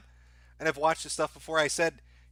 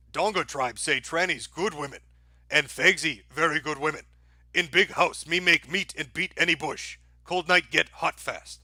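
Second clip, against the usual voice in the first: Donga voice. Pretend. Streamer trying the requested Donga voice for a viewer. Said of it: "Let me try Donga voice".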